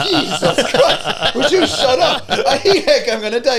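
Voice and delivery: high, slow, laughing; laughing fit. Retold or imitated. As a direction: squeal it through helpless laughter. Laughing fit in retold quote.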